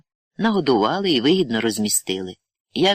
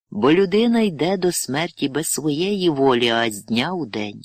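plain reading voice

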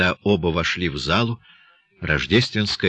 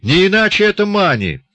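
high, loud burst of realisation; light up and exclaim it; beaming, excited exclamation; sudden delight; 13 semitones higher, 7 dB louder than usual. A narrator's reading. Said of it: "И вдруг просиял".

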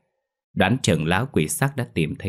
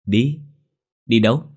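higher, louder, puzzled; confused friend asking where they're going; bewildered question; 4 semitones higher, 4 dB louder than usual. Acted. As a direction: caught off guard, asks where. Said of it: "Tí ngơ ngác hỏi".

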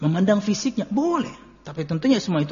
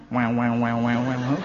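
mock wobble; 7 semitones lower, slower than usual. Mimicking playing with cheeks.

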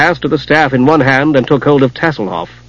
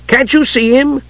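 kender voice; 12 semitones higher than usual. Acted. Tas's voice in the story.

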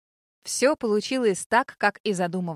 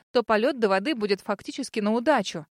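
ordinary delivery, nothing marked

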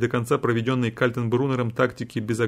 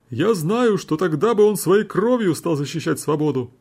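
exclaiming with pathos; 6 semitones higher, 3 dB louder than usual. A narrator's reading. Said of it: "с пафосом воскликнул защитник".

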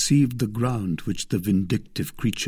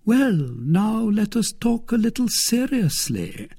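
Milady, turning serious; 13 semitones higher, 3 dB louder than usual. A narrator's reading. Lady drawing suitor into her scheme, high and serious. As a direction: get down to business as a poised lady.

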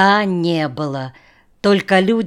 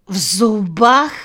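higher, louder, slow incredulous question; astonished; 4 semitones higher, 5 dB louder than usual. A narrator's reading. Shasha hears of equipment in the teeth, astonished. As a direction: echo it in sheer disbelief. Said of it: "присел от неожиданного сообщения".

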